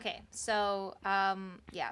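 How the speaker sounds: ordinary talk, nothing marked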